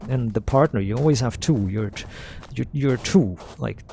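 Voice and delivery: normal talking voice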